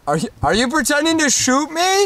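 high-pitched disbelief, stumbling at the start; incredulous question. Acted. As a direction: ask it in baffled alarm. Cameraman incredulous at mimed shooting.

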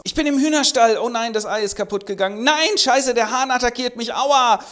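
high, loud squealing fox voice; hammed-up panicking vixen; panicked vixen. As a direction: flap about as the frantic fox. Voicing the vixen in henhouse.

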